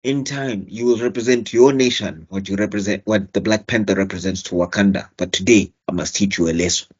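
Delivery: low, grave king's voice, a hint of menace; mock stern warrior king lecturing; lightly voiced T'Challa. Podcaster voicing T'Challa scolding Steve Rogers, low and grave. Reenacting T'Challa's lines to Cap.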